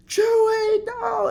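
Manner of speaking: very high, loud mock wail; imitated anguished crying. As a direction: cry out in hammed-up grief. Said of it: "crying, saying".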